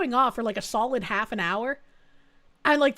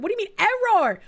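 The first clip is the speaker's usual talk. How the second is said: puzzled, irritated ask; what is this error, mildly fed up; first annoyed take; annoyed question; 6 semitones higher, 4 dB louder than usual. Real, not acted. Steam store fails to load.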